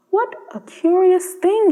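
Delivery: high and slow, puzzled wonder; a curious, wondering exclamation; surprised cry. Acted. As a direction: swallow puzzled by the drop of rain. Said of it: "he cried".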